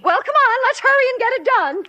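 high, quick urging; anxious hurrying. Acted. Friend urging cleaners to finish, hurried. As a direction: push them to get moving.

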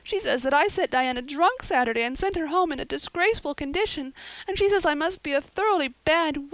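put-on high, loud, rising girlish despair; dramatic lament. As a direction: pour out the woe like a tragic heroine.

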